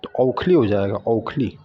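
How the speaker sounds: औखली said with औ, "aukhli"; औखली with the wrong औ vowel; गलत औखली. Shown as a demonstration. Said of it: "आपने औ लगा दिया".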